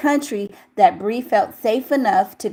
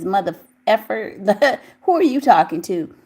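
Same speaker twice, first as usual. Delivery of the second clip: ordinary talk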